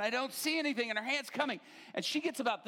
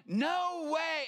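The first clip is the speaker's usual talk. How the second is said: higher, startled cry of disbelief; retold shout; 6 semitones higher than usual. Going back with arms thrown up, shouting out. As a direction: shout it out as if falling backwards. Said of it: "I shout out".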